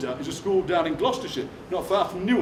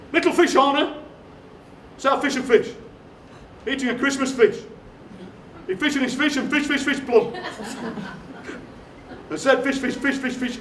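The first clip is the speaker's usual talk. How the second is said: higher, projected verse reading; nursery rhyme recital; 6 semitones higher than usual. Acted. Poet reads a daft nursery rhyme to the audience, projected recital. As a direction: recite it out to the whole room.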